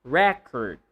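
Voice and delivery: RECord, strong REC and weak cord; Record falling from a stressed REC; stress on first syllable. Shown as a demonstration. Teacher repeats the noun form, first-syllable stress. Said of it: "if you're talking about the noun".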